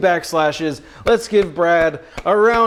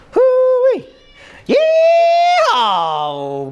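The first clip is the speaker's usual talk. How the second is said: high, loud hillbilly holler; Southern whoop; 5 dB louder, much slower than usual. Pretend. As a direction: let out a long, hammed-up yee-haw. Hammed-up Southern holler for chat.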